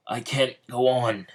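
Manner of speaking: worn-out hero admitting defeat; desperate plea. Acted. Despairing quote as he collapses.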